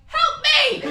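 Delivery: high, loud comic plea for help; mock cry for help. Comedian playing a desperate single person, loud high plea. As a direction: shriek it like you're desperate. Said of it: "And you're like".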